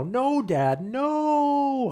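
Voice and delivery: put-on high, loud child's protest; dramatic whine. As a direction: ham up a kid's dramatic "no". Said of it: "Hunter cries out in sort of familiar dramatic expression".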